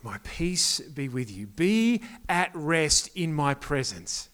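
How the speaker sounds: slow, soft and gentle, fading to a whisper; calm a frightened friend, low and unhurried; Jesus gently soothing Thomas, slow and hushed; tender reassurance. Acted.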